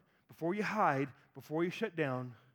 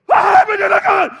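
much louder, much higher startled yell; startled shout; 13 semitones higher, 20 dB louder than usual. Retold or imitated. Son jolted from sleep ready to fight, startled yell. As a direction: jolt awake swinging. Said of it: "goes from like REM dreaming to".

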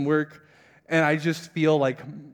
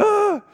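short, high, loud cry of agony; hammed-up exasperated yelp; mock frustrated scream; 6 dB louder than usual. Fasting man hears pizza is coming, mock screaming. Reacts to pizza being catered.

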